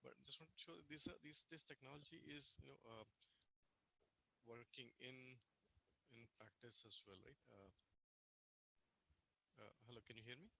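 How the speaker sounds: faint, distant mic sound; barely audible, mic turned way down; very low mic level. Real, not acted. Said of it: "Sir, your voice is very low".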